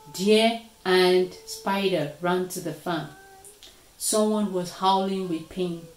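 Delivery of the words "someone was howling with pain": plain reading voice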